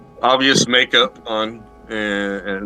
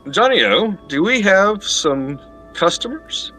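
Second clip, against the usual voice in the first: lady calling out; 9 semitones higher than usual. Acted. GM voices lady calling downstairs.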